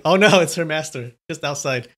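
ordinary delivery

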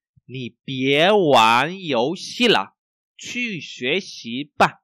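slow, syllable-by-syllable model reading; slow, carefully enunciated Mandarin for learners. Shown as a demonstration. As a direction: read slowly so each tone is heard. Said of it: "mình đọc rất là chậm".

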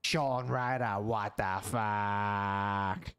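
put-on low, very slow old raver; grizzled old man voice. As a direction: drag it out like a washed-up raver. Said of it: "an old grizzled old man voice".

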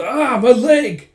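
loud, high, slow cry of pain; pained scream. Acted. Thug whose knee was cut crying out in pain, loud and drawn out. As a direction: howl over the cut leg.

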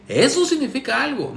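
Eso significa algo. jumps high with mock wonder; hammed-up sarcastic repeat, pitch way up on Eso; mock amazement. Shown as a demonstration. Mocking Alex about his ex's photos, high mock awe. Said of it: "Soy muy sarcástico".

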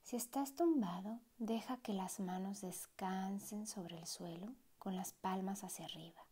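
unhurried meditation voice with long pauses; guided meditation. Acted. Meditation guide easing a listener into relaxed breathing, guided meditation voice. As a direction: guide the listener gently, letting each phrase settle.

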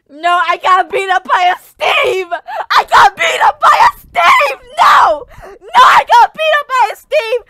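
high crying shouts; laugh-crying outrage; anguished yelling. Real, not acted. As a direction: howl in disbelief. Beaten by a default player.

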